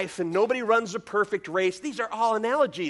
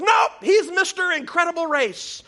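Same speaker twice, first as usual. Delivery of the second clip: put-on high, loud little-boy insistence shout; insistent kid; 9 semitones higher, 4 dB louder than usual. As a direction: correct the grown-ups as a stubborn small boy. Boy insists, correcting his parent.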